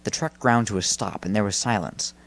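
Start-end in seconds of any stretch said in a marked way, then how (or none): none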